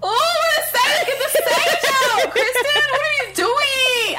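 high, loud laughing plea; nervous laugh. Real, not acted. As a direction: laugh helplessly while pleading. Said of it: "nervously laughing".

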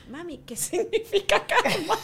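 put-on high, slow child-like question; imitating a bewildered kid asking mom; puzzled daughters. Daughters ask their mother what karma means, puzzled. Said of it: "mis hijas me vieron y me dijeron".

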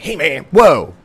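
a bot cutting in, startled; mock bot scolding. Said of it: "Some other bot was like".

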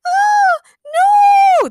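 very high, loud falsetto shriek; screamed in terror. A narrator's reading. Daniela seeing a monster behind Daniel, terrified scream. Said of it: "Daniela screamed".

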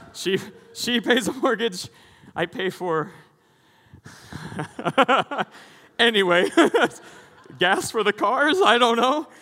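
laughing through words, high and loud. Real, not acted. Pastor laughing at his own joke about his wife paying the mortgage. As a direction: giggle while joking about the wife.

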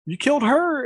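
high, panicked voice; a scared kid's cry, lightly imitated; mock frightened accusation. Terrified kid accusing the man over the girl, high and panicked.

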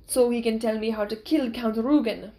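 put-on loud-edged, foreign-accented swordsman; accented, determined young fighter voice; Inigo, intense swordsman. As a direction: voice the avenger with an accent.

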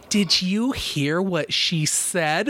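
slow, exaggerated enunciation, word by word; drawn-out, over-articulated mock reproach; over-enunciating. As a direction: imitate a scolder spelling out every word. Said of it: "she's, like, over enunciating everything".

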